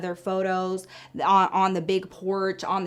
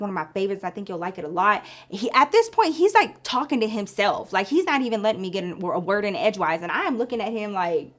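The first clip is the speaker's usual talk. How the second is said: normal talking voice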